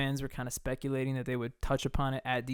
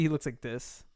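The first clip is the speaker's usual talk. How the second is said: ordinary talk, nothing marked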